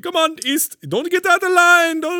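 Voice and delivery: put-on high, loud Middle Eastern accent, urging; hammed-up Middle Eastern accent hurrying people along; mock Middle Eastern accent. Ride operator calling panicking riders back into line, mock Middle Eastern accent. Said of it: "He's like".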